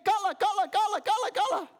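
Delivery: high, loud excited chant; shouting crowd. Retold or imitated. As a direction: yell it like cheering onlookers. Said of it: "Everyone's shouting".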